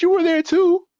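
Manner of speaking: normal talking voice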